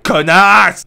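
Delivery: slow, loud drawn-out connasse; spiteful insult. Acted. Narrator insulting the child, loud and slow. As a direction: drag the insult out with venom.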